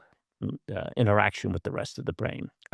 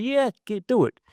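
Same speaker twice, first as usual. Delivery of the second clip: put-on New York accent; 12 semitones higher than usual. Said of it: "in his New York accent".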